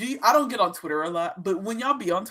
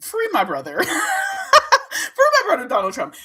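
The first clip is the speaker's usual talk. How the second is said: high, slow, laughing "Free my brother"; laughing plea; 11 semitones higher, 4 dB louder than usual. Real, not acted. Mocking Trump fans, laughing as she pleads. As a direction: beg through laughter, mocking.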